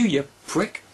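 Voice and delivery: ordinary talk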